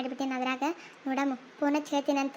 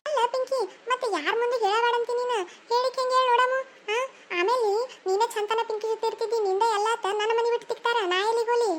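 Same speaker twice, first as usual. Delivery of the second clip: higher, fretful sister-in-law; a higher voice torn between duty and fear; worried sister-in-law; 6 semitones higher than usual. Acted. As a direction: plead with her, pitched up.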